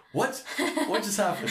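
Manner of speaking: high, laughing imitation of astonishment; mock shock. Russian girls caught gossiping, high laughing mock shock. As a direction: squeal it as the startled girls, half laughing.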